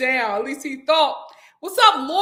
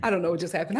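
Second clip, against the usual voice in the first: usual speaking voice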